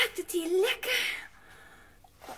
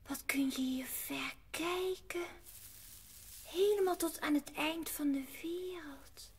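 awed whisper; 3 semitones lower, 9 dB quieter than usual. Acted. Heidi marvelling at the view from the hayloft, whispered. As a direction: breathe it out in amazement.

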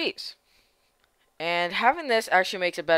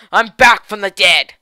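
excited; 11 dB louder than usual. Real, not acted. Hyped announcement.

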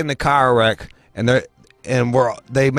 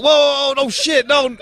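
very high alarmed protest; acted panic. Patient as the medics come to him, high mock panic. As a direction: act out frantically warding someone off.

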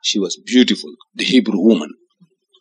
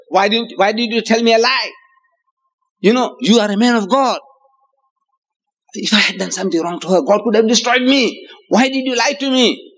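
raised, higher-pitched protest of a wronged king; indignant king; 6 semitones higher than usual. Acted. King accusing Ibrahim of lying, indignant and high. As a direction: demand an answer, affronted.

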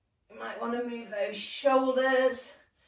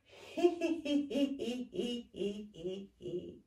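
breathy "hee hee" laughter, fading away; hee laugh demo; 5 dB quieter, slower than usual. Shown as a demonstration. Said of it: "we're gonna have a bit of a".

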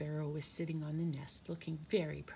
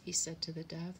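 ordinary delivery

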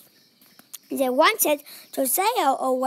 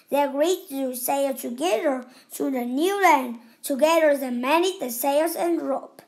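usual speaking voice

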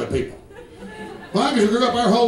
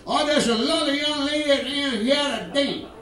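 exaggerated South Carolina politician voice; Strom Thurmond; 4 semitones higher than usual. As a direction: garble it in a high South Carolina accent. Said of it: "you talk like Strom Thurmond".

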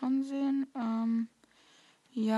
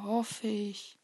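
ordinary talk

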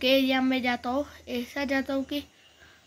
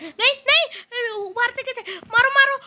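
very high, alarmed yelling; panicked outburst; 11 semitones higher, 6 dB louder than usual. Real, not acted. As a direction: cry out in alarm, ending in a loud shout. Enemy attacks from above. (घबराकर ऊँची आवाज़ में चिल्लाना)

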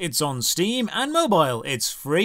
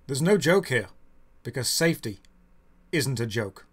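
mock solemn; 6 semitones lower than usual. Mock-solemn aside about helmet safety.

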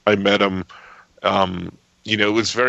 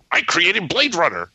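higher-pitched mock exclamation; 9 semitones higher than usual. Stan discovering his old comic resembles Blade Runner, mock exclamation. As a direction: exclaim it in a raised, put-on voice. Acts out Stan's reaction.

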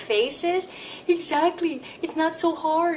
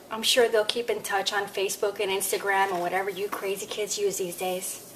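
quick aside; 6 semitones lower, 6 dB quieter, faster than usual. Real, not acted.

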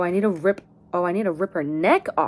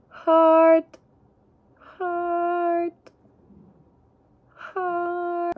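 squealing; 9 semitones higher, much slower than usual. Real, not acted. Showing the heart pieces.

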